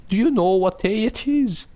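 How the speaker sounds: put-on high falsetto old woman's voice; old lady asking. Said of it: "she asked again".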